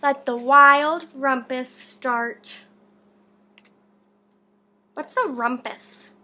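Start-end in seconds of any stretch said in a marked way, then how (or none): 0.0-2.6 loud and slow, savouring each word; grand slow proclamation. Acted. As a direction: declare the party open like a king. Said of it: "cried Max".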